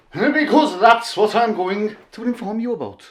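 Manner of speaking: put-on switching accents clumsily into Scottish; a quick put-on Scottish accent. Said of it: "I just went a little bit Scottish there now".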